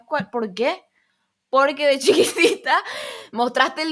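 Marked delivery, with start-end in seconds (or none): 1.5-2.8 laughing through the words. Real, not acted. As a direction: giggle as you say it. Laughing at her own point.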